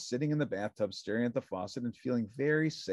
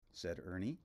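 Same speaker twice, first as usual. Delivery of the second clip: plain reading voice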